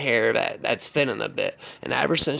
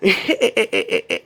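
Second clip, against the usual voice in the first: high put-on crazed cackle; crazy laugh; 7 semitones higher than usual. Woman laughing at her own threat, crazy high cackle. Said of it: "that crazy laugh she always did".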